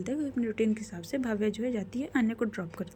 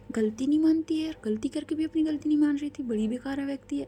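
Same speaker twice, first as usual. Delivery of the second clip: higher-pitched grumbling about someone; voiced grievance, pitched up; indignant complaint; 5 semitones higher than usual. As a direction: complain in a raised, exasperated pitch. Acted complaint about a stubborn boss.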